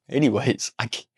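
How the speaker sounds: ordinary delivery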